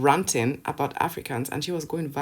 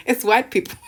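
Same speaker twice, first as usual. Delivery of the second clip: high, loud, through laughter; laughing helplessly at the realization; cracking up; 8 dB louder than usual. Real, not acted. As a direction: barely get it out while laughing.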